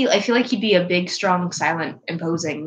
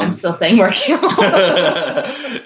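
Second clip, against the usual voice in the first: laughing; 6 dB louder, much slower than usual. Real, not acted. Insisting on joke pick.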